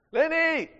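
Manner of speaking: high, loud call through the door. Retold or imitated. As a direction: shout the name to wake the house. Alex banging on door at night.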